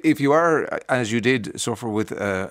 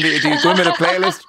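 laughing; 5 semitones higher, 5 dB louder, faster than usual. Real, not acted. Host jokes about rock band.

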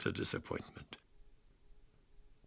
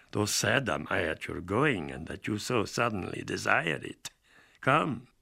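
a velvet host who won't let his guest go; sweet courteous Count; 5 semitones higher than usual. Acted. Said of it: "said with a sweet courtesy".

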